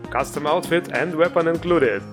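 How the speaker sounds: ordinary talk, nothing marked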